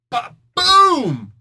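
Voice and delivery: high, very loud boom; explosion boom. Retold or imitated. Narrator acts out the hit, very loud high boom. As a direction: blast it like a comic explosion.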